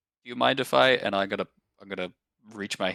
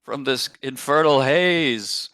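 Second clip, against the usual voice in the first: loud, raised proclamation; shouting out; 6 semitones higher, 10 dB louder, slower than usual. Acted. Player shouts out thanks for deliverance, loud proclamation. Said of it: "I'll shout out".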